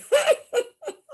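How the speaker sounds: high loud mocking laughter; hearty laugh. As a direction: laugh at the city folks. Storekeeper laughing at them.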